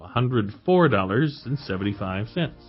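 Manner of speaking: ordinary delivery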